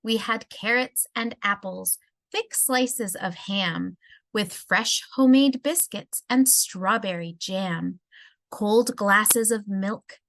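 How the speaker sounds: ordinary delivery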